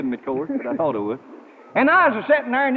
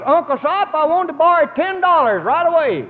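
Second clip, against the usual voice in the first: pitched up high to be heard; 7 semitones higher than usual. Retold or imitated. Repeating the request to Uncle Si over a bad line, pitched up high. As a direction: raise it up high so he hears. Rod, I can't hear a word you're saying.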